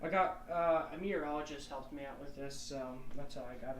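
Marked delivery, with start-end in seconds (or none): none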